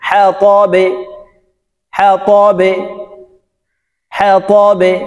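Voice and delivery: "হা তবে" with the ব bounced downward; downward qalqala. Shown as a demonstration. Teacher leading repetition of "হা তবে", downward qalqala. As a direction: echo the end of "হা তবে" on a short e. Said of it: "নিচের দিকে ধাবিত করে".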